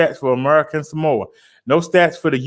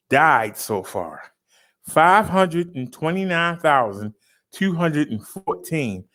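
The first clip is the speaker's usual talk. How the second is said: ordinary talk, nothing marked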